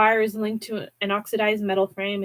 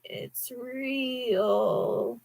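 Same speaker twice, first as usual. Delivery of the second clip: a soft, lightly scratchy rasp, quieter; a hint of a rasping animatronic; lightly voiced scratchy creature; 3 semitones higher, 6 dB quieter than usual. A narrator's reading. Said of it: "a scratchy voice said".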